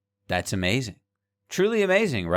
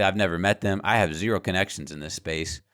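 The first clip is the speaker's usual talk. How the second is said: usual speaking voice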